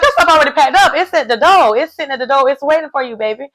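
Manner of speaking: high, loud teasing sing-song; taunting ex. Retold or imitated. Jeremy teasing Laura that her stuff is already packed. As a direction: mock-sweetly rub it in. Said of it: "he was like".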